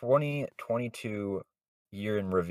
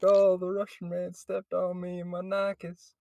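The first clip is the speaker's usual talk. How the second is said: hillbilly voice; 9 semitones higher than usual. Retold or imitated. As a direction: ham up the dumb hillbilly. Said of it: "Me doing the hillbilly".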